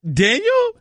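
high, startled question; surprised. Retold or imitated. Spotting Daniel Chopra in the dining room, surprised. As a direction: jump up in pitch at seeing him.

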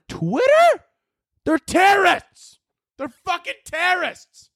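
high, slow, incredulous outrage; squeaky outraged rant; outraged high yell. Real, not acted. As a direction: shriek it in disbelief. Ranting about Taliban on Twitter.

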